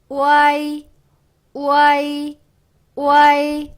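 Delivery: 우아이 gliding u-a-i on a high level tone; loud, held 우아이 with all three vowels joined; pronunciation demo. Shown as a demonstration. Teacher showing learners the triple vowel, pronunciation demo. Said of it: "이렇게 발음이 돼요".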